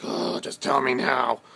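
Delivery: slow, drawn-out mock whine; whiny begging. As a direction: beg like an impatient kid, slowly. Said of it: "I'm like".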